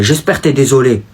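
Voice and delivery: usual speaking voice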